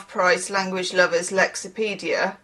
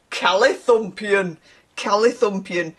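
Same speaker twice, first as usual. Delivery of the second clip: northern English accent. Shown as a demonstration. Reader relishing an odd word, in a northern English accent. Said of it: "say that in a northern accent".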